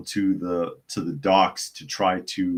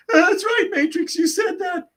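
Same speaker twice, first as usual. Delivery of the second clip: very high, squeaky mock plea; panicked pleading; 4 dB louder than usual. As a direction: beg for your life in a hammed-up squeal. Acting the pleading movie villain.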